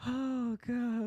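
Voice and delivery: very high, laughed-out exclamation; helpless amusement. Real, not acted. Host cracks up at co-host's joke, helpless amusement. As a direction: squeal it out through real laughter. Recovering from laughing at wild move.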